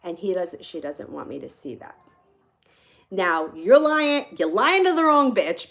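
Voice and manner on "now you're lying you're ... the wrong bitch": loud, rising angry call-out; indignant outburst. Retold or imitated. Storyteller calls out the absent woman's lie, indignant. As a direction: hit it loud and high, accusing.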